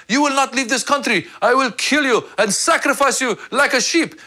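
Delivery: high, threatening villain husband; hammed-up menacing threat. Husband turns on his wife in Iran, mock menacing.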